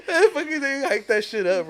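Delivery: words squeezed out through laughter, high; telling friends the gross part, laughing through it; laughing while talking. Real, not acted. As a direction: talk while cracking up.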